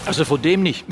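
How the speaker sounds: normal talking voice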